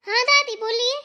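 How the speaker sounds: high, loud, irritated answer; angry reply. Acted. Granddaughter-in-law answering grandmother's call, angry. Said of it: "इतने गुस्से में क्यों बोल रही हो".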